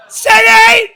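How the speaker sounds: piercing high shouted call; dog-name call sound. Shown as a demonstration. Owner hollers for his dog, high loud call. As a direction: holler it far above talking pitch. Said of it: "that's his name".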